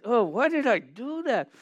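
a touch of hammed-up remorse; mock remorseful lament. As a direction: groan it out like you just messed up. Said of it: "I get like this".

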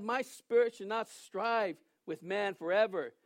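normal talking voice

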